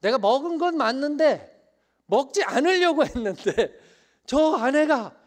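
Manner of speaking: high, loud excuse-making Adam; Adam blaming. Acted. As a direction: whine out his excuse. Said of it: "아담처럼". (변명하며 아내를 탓하는 아담, 높고 큰 소리)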